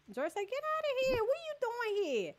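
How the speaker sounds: high teasing get-out; mock shooing. Real, not acted. Streamer teasing Jersey to leave the stream, high mock shooing. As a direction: shoo the friend off playfully, up high.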